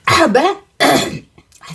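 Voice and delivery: slow, interrupted by a cough. Real, not acted. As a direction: clear the throat between words. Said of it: "ça dégage le miel". (voix prise par la toux)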